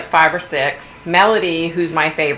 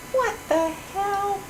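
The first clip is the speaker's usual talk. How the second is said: much higher, slow incredulous squeak; shocked disbelief; 13 semitones higher, 8 dB quieter, much slower than usual. Real, not acted. Reading about the widowed mom of eight, much higher shocked disbelief. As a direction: let the disbelief pitch up and stretch. Reacting to shocking show description.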